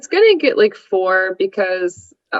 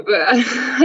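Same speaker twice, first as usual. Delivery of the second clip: giggle; 2 semitones higher than usual. Real, not acted. Laughing at her own review.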